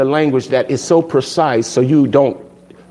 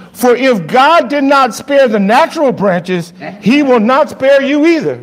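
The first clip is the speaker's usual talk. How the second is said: scripture reading; 10 semitones higher, 4 dB louder than usual. Acted.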